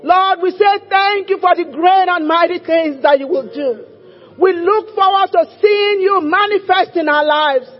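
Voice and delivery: loud fervent declaring prayer; preaching prayer. Acted. Preaching prayer at full force.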